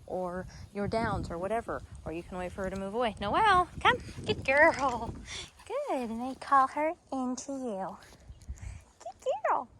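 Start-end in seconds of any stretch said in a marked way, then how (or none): 3.2-4.0 high, loud recall call; sing-song recall to the puppy; calling the dog. Real, not acted. 4.2-6.1 higher, louder praise; happy praise to the dog; puppy praise. Real, not acted. Praising puppy after recall. 9.0-9.7 very high cooing praise. Real, not acted. Cooing at tiny puppy.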